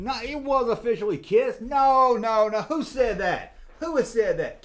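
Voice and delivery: loud, high insistent no, no, no; indignant protest. Real, not acted. Streamer correcting a viewer about Kiss, loud and indignant. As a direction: shout down the wrong claim. Indignant reply to chat claim.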